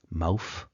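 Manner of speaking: "mouth" said with a final F. Shown as a demonstration. Lecturer showing TH replaced with F, "mouth" ending in F. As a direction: end "mouth" on an F sound, as in Cockney. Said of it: "it has been replaced with an F".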